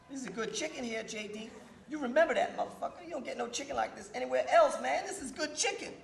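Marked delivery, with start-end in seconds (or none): none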